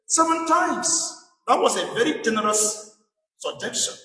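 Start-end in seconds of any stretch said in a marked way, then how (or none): 0.1-1.2 slow, weighed offer, a little higher; slow Peter quote. Retold or imitated. Preacher quotes Peter to Jesus, slow and deliberate. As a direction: Peter stretching out his generous number. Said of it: "he said to him".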